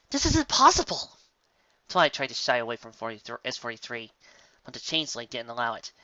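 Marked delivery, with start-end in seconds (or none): 0.1-1.1 high, loud panicked cry; panicked disbelief. A narrator's reading. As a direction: Twilight can't believe it, near panic. Twilight panics.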